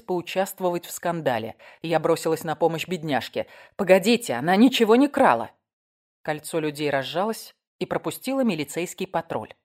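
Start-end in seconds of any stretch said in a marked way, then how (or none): 3.8-5.5 raised voice, pleading on her behalf; higher, insistent protest; urgent intervention. Acted. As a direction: break in loudly to stop them. Narrator intervenes to defend woman.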